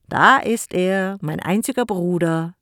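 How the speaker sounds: loud, slow, overdone cheer; exaggeratedly lively. Jakko greets his brother Jim, hammed-up loud cheer. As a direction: greet him with forced enthusiasm. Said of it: "sagte Jakko übertrieben lebhaft".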